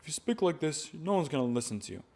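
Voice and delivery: soft, held-back speaking voice; weak, non-commanding delivery; unprojected speech. Shown as a demonstration. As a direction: speak quietly with the voice fallen back. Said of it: "you don't want to speak like this".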